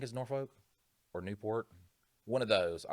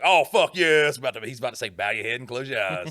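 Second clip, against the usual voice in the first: hammed-up eager burst, much louder and higher; mock excitement; 5 semitones higher, 10 dB louder than usual. Bored churchgoer thinking the preacher is finishing, mock-thrilled. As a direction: jump on it like freedom is coming.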